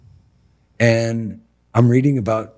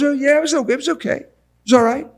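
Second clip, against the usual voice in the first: much higher, hesitant mock voice of a client; imitating Ron; 3 dB louder, much faster than usual. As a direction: mimic the client's lukewarm shrug in a high voice. Mimicking Ron's unsure answer.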